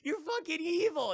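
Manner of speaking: high, loud, fast accusation; hammed-up horror-story accusation; accusing outburst. Voices character accusing Cherry.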